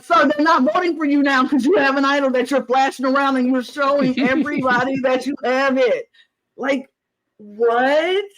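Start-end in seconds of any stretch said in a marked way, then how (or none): 0.0-6.1 fast, higher exasperated voice; exasperated lecture. Retold or imitated. Retelling her own reply to the player, fast and exasperated. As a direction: rattle it off like you're fed up. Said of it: "I'm like".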